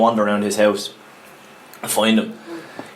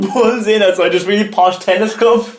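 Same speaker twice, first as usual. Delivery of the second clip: higher voice, real laughter spilling into the telling; laughing; 8 semitones higher than usual. Real, not acted. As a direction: tell it while cracking up. Teller cracking up at own story.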